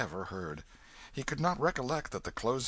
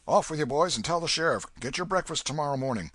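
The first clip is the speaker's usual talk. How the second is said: brisk loud orders; 7 semitones higher, 6 dB louder, faster than usual. Acted. Old man giving orders.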